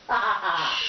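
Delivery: ordinary talk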